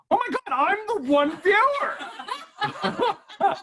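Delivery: high-pitched comic dismay, stretched out; mock horrified realization. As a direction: play the dawning shock way up high. Acted shock at being the only viewer.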